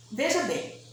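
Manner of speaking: ordinary delivery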